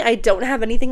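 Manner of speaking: ordinary delivery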